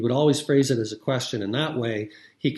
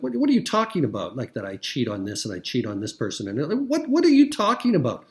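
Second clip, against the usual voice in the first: outraged target; 3 semitones higher, 4 dB louder than usual. Retold or imitated. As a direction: burst out in shocked outrage, pitch shooting up. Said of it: "anybody take an issue with that".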